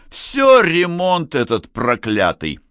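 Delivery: slow, loud exclamation of annoyance; vexed. A narrator's reading. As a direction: curse it with drawn-out vexation. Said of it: "расстроился Гарик". (с досадой, громко и протяжно)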